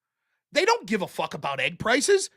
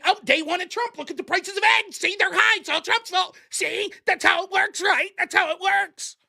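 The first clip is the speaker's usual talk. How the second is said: mock rant; 6 semitones higher than usual. Pundit parodies Democrats blaming Trump on day one, higher mock shouting. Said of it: "then we're just gonna say".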